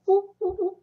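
high, playful monkey hooting; monkey hoots. Retold or imitated. Teacher showing the monkey sign, hooting playfully like a monkey. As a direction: hoot like a monkey for the kids.

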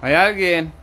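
calling from far away. Real, not acted. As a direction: call out to a ghost. Calling out into the building.

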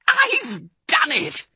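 high, slow triumphant cry; excited yell. A narrator's reading. Mister Fox to his family, high triumphant yell. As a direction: shout it with joy. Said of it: "he yelled".

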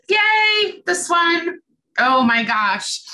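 high, loud delighted yay; excited squeal. Real, not acted. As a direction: squeal with joy at the slide. Delighted by next owl slide.

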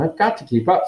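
lightly voiced brisk girl. A narrator's reading. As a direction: say it briskly, no nonsense. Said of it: "said Hermione briskly".

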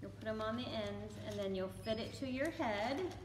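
ordinary talk

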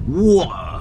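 slow British "water" with the r dropped; British accent. Shown as a demonstration. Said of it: "you go for that British accent".

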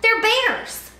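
high loud scornful bully; bully yelling. A narrator's reading. Sammy mocks Kevin's idea, scornful bully. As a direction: scoff it like a know-it-all kid. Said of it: "he yelled".

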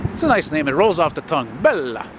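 ordinary talk

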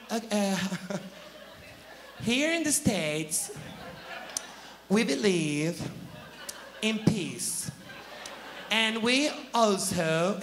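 slow, halting English answer; hammed-up flustered contestant; fumbling contestant. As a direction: stumble through it like a lost pageant girl.

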